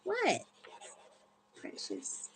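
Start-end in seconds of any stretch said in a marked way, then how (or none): 1.6-2.3 whisper. Real, not acted. Whispered to pet.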